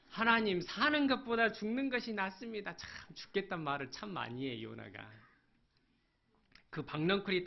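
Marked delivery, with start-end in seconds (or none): 0.1-2.7 높고 크게 터뜨리는 불평; Jonah's loud complaint. Acted. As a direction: cry it out to God, fed up. Preacher voices Jonah's complaint. (하나님께 크게 항변하는 요나)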